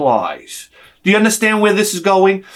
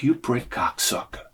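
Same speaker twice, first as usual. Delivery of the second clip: muttered insult; 7 semitones lower, 11 dB quieter than usual. Retold or imitated. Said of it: "And I'm like".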